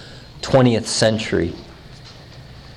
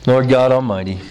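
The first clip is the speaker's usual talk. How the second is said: closing prayer; 2 semitones lower, 3 dB quieter than usual. Real, not acted.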